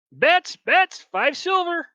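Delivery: high, loud little goblin bookie. Acted. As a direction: squeak out bets like an eager goblin. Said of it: "the little goblin goes".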